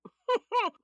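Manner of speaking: sharp high loud laugh of disbelief; scoffing laugh. Acted. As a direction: burst out laughing at him. Scoffing at ex-husband's claim.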